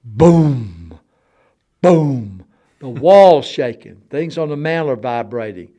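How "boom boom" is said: loud, resonant drawn-out booms; imitated wall booms. Telling of banging through the bedroom wall, loud imitated booms. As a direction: boom it out like thuds through a wall. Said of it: "in the bedroom through the wall".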